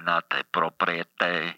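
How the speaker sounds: put-on Italian accent as the Pope; thick Italian-English accent, playing the Pope; comic Italian accent as the Pope.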